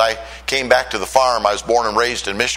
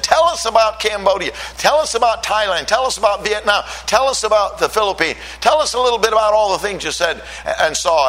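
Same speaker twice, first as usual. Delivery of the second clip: much higher, eager relatives asking; eager family questions; 9 semitones higher than usual. Retold or imitated. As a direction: play the curious family begging for stories. Family asking about his travels.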